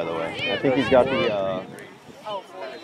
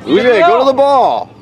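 calling out; 7 semitones higher, 6 dB louder, slower than usual. Real, not acted. Calling player from sideline.

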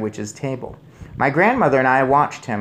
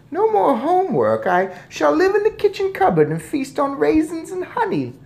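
very high squeaky voice; gleeful mouse boy; 4 dB louder than usual. A narrator's reading. Mouse boy plans life in cupboard, gleeful squeak. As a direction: crow happily in a tiny squeak.

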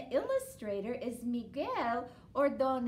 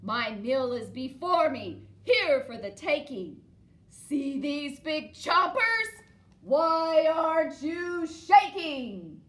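louder, slow scratchy troll menace; scritchy scratch troll voice; 5 semitones higher, 11 dB louder than usual. Acted. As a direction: scare the goat with scratchy troll threats. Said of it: "in her scritchy scratch voice".

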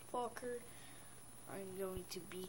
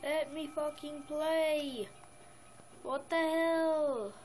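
raised, higher, drawn-out exasperation; exasperated; 5 semitones higher, 4 dB louder, slower than usual. Real, not acted. Gamer fed up with a game that won't let him play, exasperated. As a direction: complain loudly, dragging the words out.

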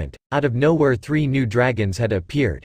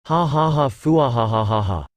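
maniacal laugh. A narrator's reading. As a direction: gloat with a big mad dragon laugh. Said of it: "began to laugh maniacally".

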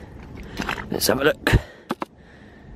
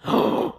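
sharp gasp of surprise; surprised gasp; 3 dB quieter than usual. Real, not acted. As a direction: gasp at a great find. Sees bottle come out complete.

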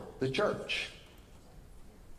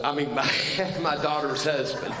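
laughing through the correction; chuckling as he fixes the slip; laughing at his slip. Real, not acted. Preacher laughing while correcting himself. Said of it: "Did I say brother-in-law".

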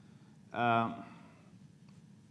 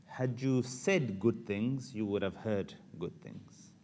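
even slower, every word weighed; preacher repeats the mom's lesson for the crowd, slow and deliberate; 4 semitones lower, 6 dB quieter, slower than usual. Shown as a demonstration. Slow emphatic repeat. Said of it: "Can I say that again?".

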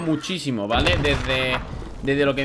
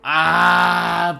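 loud startled yelp; startled cry; 3 semitones higher, 6 dB louder than usual. Real, not acted. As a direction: cry out in shock. Shot from behind.

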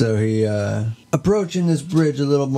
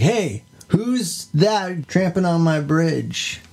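troll voice; 6 semitones higher, slower than usual. Pretend. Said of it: "the troll's like".

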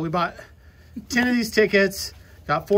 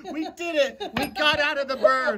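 high squealing delight; real joy at winning; excited; 10 semitones higher than usual. Real, not acted. Winning ticket, escaping the burn.

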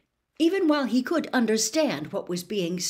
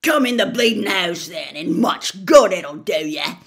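put-on angry gruff old man; 10 dB louder than usual. Gaunt still raging.

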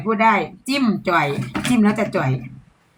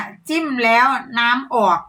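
slow demo phrase; 2 semitones higher, slower than usual. Shown as a demonstration. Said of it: "เพิ่นให้โค้ดมาแล้วเด้อ".

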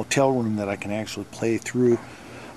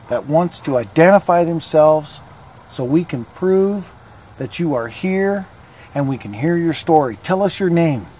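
talking to a ghost; 5 semitones higher, 11 dB louder than usual. Acted. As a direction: call out into the dark graveyard. Calls out to spirits.

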